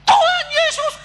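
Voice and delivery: high, loud sudden scream; piercing-high, full-voiced cry; woman's yell. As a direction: scream out the prayer. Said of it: "dia teriak".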